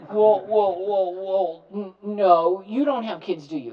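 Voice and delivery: loud, high, stumbling well well; flustered reply. Retold or imitated. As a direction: sputter like a caught-out parent. Acted caller flustered reply.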